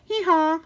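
high braying hee-haw; donkey bray. Acted. Reader brays for the donkey child.

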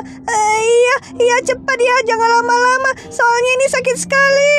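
high, tearful pleading to hurry; crying sister in pain. Acted. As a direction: sob out the plea to be quick. (kakak menangis kesakitan bersuara tinggi)

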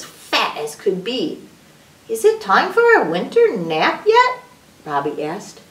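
animated storybook reading with swooping pitch; sing-song story voice, stretching key words; reading to children. A narrator's reading. Adult reads a picture book to children, animated storytelling.

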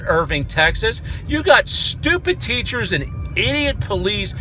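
normal talking voice

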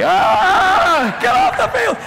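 high, loud, furious yell. Retold or imitated. Band director chasing the mascot, screaming. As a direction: scream him off the field while chasing. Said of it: "And he's chasing me".

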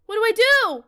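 high, loud wail of helplessness; panicked cry. Real, not acted. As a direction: cry out as if trapped. Trapped in the game, panicking.

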